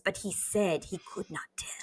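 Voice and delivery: softly whispered secret. Acted.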